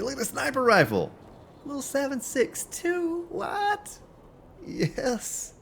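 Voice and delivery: very high, delighted squeaky voice; excited squeal. Real, not acted. Streamer squealing over a sniper rifle, very high and thrilled. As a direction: squeal with joy over the loot.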